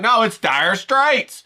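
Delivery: 1 mocking know-it-all. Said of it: "stupid and be like".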